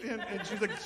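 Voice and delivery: ordinary delivery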